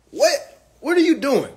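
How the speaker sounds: normal talking voice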